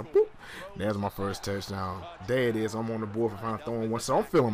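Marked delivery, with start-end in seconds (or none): 0.0-0.3 high, playful "boop"; playful sound effect. Real, not acted. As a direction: pop the word like a cartoon sound. Celebrating a touchdown throw.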